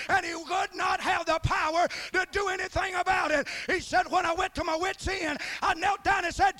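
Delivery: loud, high revival preaching; shouting preacher builds to the climax; fiery preaching. Acted. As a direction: preach it at full force. Preaching at full force.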